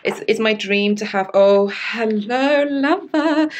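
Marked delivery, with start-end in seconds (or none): 1.3-3.5 swooning greeting. Real, not acted. Swooning at a favourite costume.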